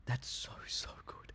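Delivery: very soft, voiceless murmur of pleasure; whispered aside. Real, not acted. Vlogger savouring something mid-video, whispered aside. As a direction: whisper it to yourself. Private aside during a pause.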